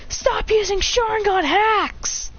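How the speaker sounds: high, drawn-out angry yell; whine-shout at a cheating opponent; slow, high-pitched protest; screaming gamer. Acted. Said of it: "All they hear is screaming from the house".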